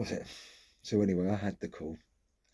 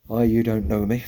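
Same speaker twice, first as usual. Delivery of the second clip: a much louder, rising hello to a stranger; louder caller's opening; 3 semitones higher, 11 dB louder than usual. Retold or imitated. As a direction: call it out loud, like a stranger breaking bad news on the phone. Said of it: "She got the".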